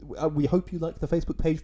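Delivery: ordinary talk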